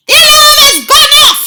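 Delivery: high, loud triumphant witch shriek. A narrator's reading. Grand High Witch shrieking to her audience. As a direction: shriek with glee. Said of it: "shrieked the Grand High Witch".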